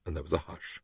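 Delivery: ordinary delivery, nothing marked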